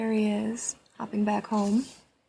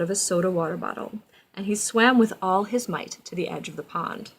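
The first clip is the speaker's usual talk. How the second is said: storybook narration; 5 dB louder than usual. A narrator's reading. Reads storybook aloud to child.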